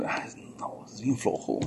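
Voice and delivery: a hushed gossiping aside; mock whisper. Mocking gossip about lazy worker. (cuchicheo burlón)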